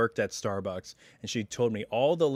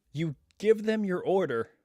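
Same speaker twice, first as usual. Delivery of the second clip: normal talking voice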